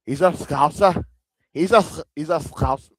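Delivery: put-on a halting try at a Liverpool Scouse accent; attempted Scouse accent. To his chat, failing at a Scouse accent. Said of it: "I can't even do it".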